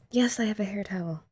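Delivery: hushed aside about the towel; whisper. Real, not acted. Whispering an aside to the camera about her hair towel. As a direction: whisper it like a small confession.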